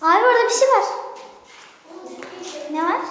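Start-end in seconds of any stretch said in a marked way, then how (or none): 0.0-0.8 high, loud frightened call; yelling across the room. Real, not acted. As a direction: call out in alarm, pitched up. Hears a noise and calls out.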